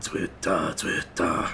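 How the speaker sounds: low growled Zwitter, half breath; growly voice. Shown as a demonstration. Showing off his growly voice for the chorus. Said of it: "my growly till voice".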